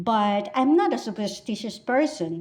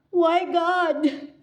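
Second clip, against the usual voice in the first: high-pitched, hammed-up lament; wailing mourner; 6 semitones higher than usual. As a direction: cry out to God in mock anguish. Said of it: "they're all moaning and weeping and screaming".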